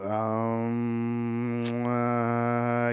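long, drawn-out droning um; imitating someone dragging out an awkward hesitation; reluctant hesitation. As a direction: stall on a held, level note. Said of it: "the guy did one, he's like".

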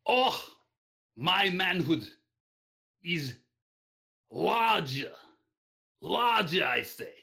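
booming, high hammed-up Russian accent; heavy Russian accent. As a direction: bellow it in a thick Russian accent. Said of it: "in the most heavy Russian accent you can muster".

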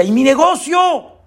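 high, loud cry; panicked cry. Acted. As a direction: shout it in a panic. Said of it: "Y el Señor grita".

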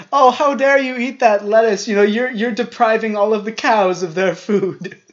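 high, loud mock indignation; shrill mock outrage. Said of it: "They're like".

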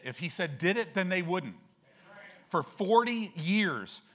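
ordinary talk

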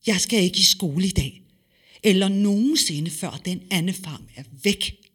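determined near shout. Acted. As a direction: make a stand, almost shouting. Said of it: "nærmest råbte Gitte". (Gitte erklærer højt og trodsigt)

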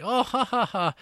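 high, hammed-up titter; mock high laugh of an uneasy woman; mock nervous laugh. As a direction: squeak out a fake nervous laugh. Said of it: "it's like".

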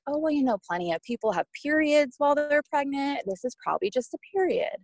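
lightly mimicked "it's nothing" reassurance; breezy reassurance. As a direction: retell it high, brushing the worry aside. Triage worker brushing off worry.

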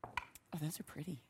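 soft admiring whisper. Real, not acted. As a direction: breathe it out in a whisper. Admiring dice under breath.

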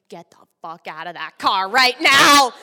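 loud order building to a yell; acted-out angry command; furious mom. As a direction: bellow the final words at your kid. Furious mom opens the car door.

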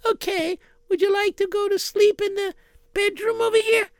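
soft, high, timid housewife voice; gentle wife voice. Acted. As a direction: ask politely in a high, hesitant voice.

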